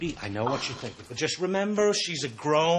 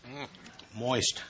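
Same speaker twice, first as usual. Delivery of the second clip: ordinary talk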